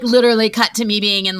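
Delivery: normal talking voice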